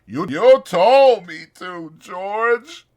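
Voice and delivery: Lenny cowering, explaining to George; miserable. Acted. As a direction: plead in misery. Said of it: "he said miserably".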